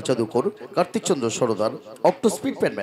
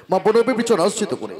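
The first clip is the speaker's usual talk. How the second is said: rising loud invocation; 10 semitones higher, 3 dB louder than usual. Real, not acted.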